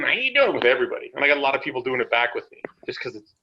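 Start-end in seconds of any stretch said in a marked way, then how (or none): 0.0-0.6 high hillbilly greeting; high hillbilly howdy. Retold or imitated. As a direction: throw out the silly hillbilly hello. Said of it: "I was doing".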